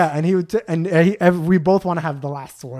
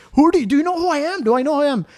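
put-on high, fast, indignant Indian accent; hammed-up Indian-accented bluster; Indian accent imitation; 10 semitones higher, 3 dB louder, much faster than usual. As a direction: mimic an Indian man spluttering in outrage. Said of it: "Indian accent".

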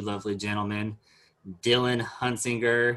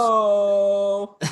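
amused laugh; 10 semitones higher, 4 dB louder than usual. Real, not acted. Laughing at Dylan's segue.

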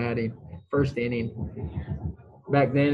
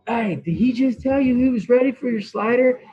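higher, louder incredulous question; acted-out astonishment; 10 semitones higher, 5 dB louder than usual. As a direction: play a teammate who can't believe it. Teammate's astonished question retold.

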